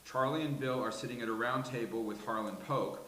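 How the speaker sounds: ordinary talk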